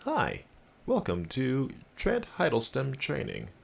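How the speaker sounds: slow, swooping corporate welcome; training-video voice. Acted. Streamer showing off the Trent voice, slow presenter delivery. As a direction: greet trainees like an instructional-video host. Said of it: "I can do that voice".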